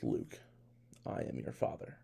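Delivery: low, hushed villain line; Darth Vader. Retold or imitated. Darth Vader turns to Luke, low and hushed. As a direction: drop low and soft, pausing after the name. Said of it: "Darth Vader turns and goes".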